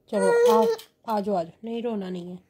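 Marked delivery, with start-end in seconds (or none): 0.1-0.6 a bright, sudden high squeal of come here; sudden high coax. Real, not acted. Mother coaxes baby to come, sudden high squeal. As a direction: jump way up in pitch to coax.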